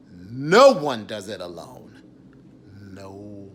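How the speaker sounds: forceful declaration. Real, not acted. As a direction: proclaim it to the room.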